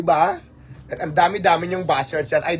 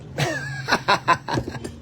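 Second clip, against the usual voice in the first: short airy laugh, falling off; a quick unvoiced chuckle; breathy laugh; 2 semitones higher than usual. Real, not acted. Laughing at bashers on the live stream, airy chuckle.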